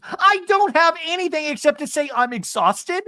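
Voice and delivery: high, loud mock protest; hammed-up high outburst; Alexis. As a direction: impersonate a worn-out drama queen at full volume. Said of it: "Alexis goes".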